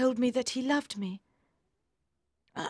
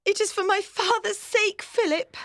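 high, louder anguished plea; anguished cry; 12 semitones higher, 4 dB louder than usual. A narrator's reading. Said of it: "cried Maggie".

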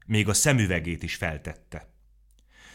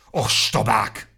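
slow, loud, scornful outburst; a tiny furious fairy scolding; 3 semitones higher, 8 dB louder, much slower than usual. A narrator's reading. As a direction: spit the word out slowly, enraged. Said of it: "méregbe gurult".

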